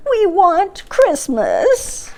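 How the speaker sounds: high, slow troll wail. A narrator's reading. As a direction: stretch it out like a troll pleading, voice rising. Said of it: "they wailed".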